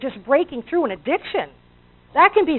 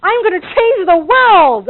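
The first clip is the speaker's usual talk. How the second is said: higher and louder, bursting with big dreams; excited; 7 semitones higher, 9 dB louder than usual. Retold or imitated. Speaker recalling leaving her news job, excited shout. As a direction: proclaim it like you can't wait. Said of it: "I got all excited about this concept".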